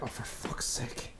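slow mock-exasperated whisper. Hero with women throwing themselves at him, exasperated whisper. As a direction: whisper it as a worn-out aside.

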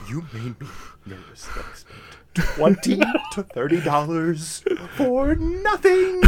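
hammed-up whine; mock complaint. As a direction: moan it like a wounded friend. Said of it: "And I'm like".

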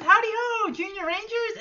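put-on high, sing-out kids' show hello; lightly hammed-up greeting. [name] greeting the Junior Rangers, lightly hammed-up.

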